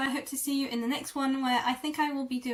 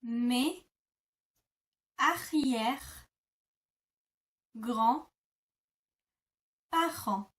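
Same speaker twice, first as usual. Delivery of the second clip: mes arrière-grands-parents split into slow chunks; slow teaching breakdown; broken down slowly; much slower than usual. Shown as a demonstration. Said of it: "Let's break that down".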